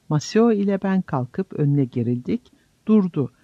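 ordinary delivery, nothing marked

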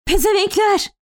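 high-pitched outraged curse; angry cry with the pitch thrown up high; Fevzi crying out. A narrator's reading. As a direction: yell the insult at an unseen enemy. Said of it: "diye haykırdı Fevzi".

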